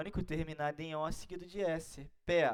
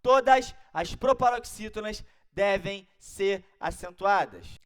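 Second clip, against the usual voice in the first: loud classroom chant of the rule; louder; 3 semitones higher, 13 dB louder than usual. Shown as a demonstration. Teacher leading the class in chanting the rule loudly. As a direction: belt out the rule for the class to repeat. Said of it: "Mais alto".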